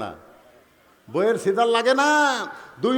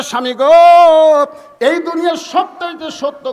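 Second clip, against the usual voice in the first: melodic preaching call; 9 semitones higher, 11 dB louder than usual. Acted. Preacher voices Fatema calling her husband, high melodic call. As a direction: call out to the husband, stretching the vowels.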